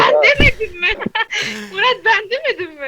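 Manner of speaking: laughing retort. Real, not acted. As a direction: gloat while cracking up. Opponent laughs while arguing. (gülerek iğneleyerek)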